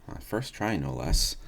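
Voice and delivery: usual speaking voice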